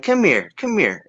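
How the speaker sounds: put-on high and slow, coaxing like a sweet old woman; an old lady gently beckoning a boy over. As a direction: slowly wave him over in her high voice.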